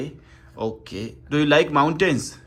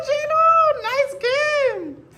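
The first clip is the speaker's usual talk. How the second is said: high sing-song praise; much slower than usual. Real, not acted.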